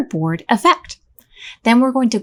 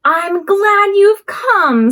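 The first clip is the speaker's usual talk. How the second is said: high happy greeting; 9 semitones higher, 3 dB louder, much slower than usual. Acted. Squeak greets arriving friends.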